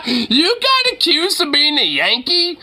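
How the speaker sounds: high, incredulous question out of laughter; amused disbelief. Real, not acted. Streamer to viewer called a Yankee, amused disbelief. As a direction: ask it cracking up in disbelief.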